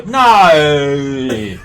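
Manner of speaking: drawn-out English no. Retold or imitated. Mocking the English way of saying "No.", long and stretched. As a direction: pull "No." out over seven syllables. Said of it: "There they go".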